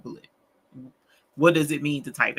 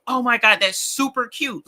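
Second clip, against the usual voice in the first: excited gushing; 6 semitones higher, 5 dB louder than usual. Acted. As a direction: gush like typing in all caps. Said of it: "when I'm excited about something".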